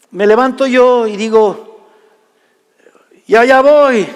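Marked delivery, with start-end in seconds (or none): 3.3-4.1 high, louder call back; called-back reply. Retold or imitated. Son answers father's yell from another room, calling back. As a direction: holler that you're on your way.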